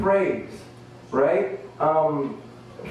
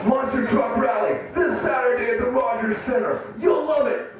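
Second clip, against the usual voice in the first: monster truck ad; 6 semitones higher than usual. Pretend. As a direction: sell the rally hard. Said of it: "doing this commercial".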